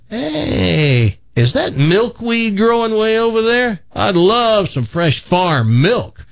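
high, drawn-out city-slicker enthusiasm; eager city guy. Acted. City guy spotting milkweed on the farm, eager. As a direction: pitch it up and stretch out the wish. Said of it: "And the city guy says".

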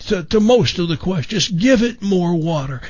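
easygoing gardener handing out simple advice; Jim McMillan impression. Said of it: "kind of a raspy voice, a little southern accent".

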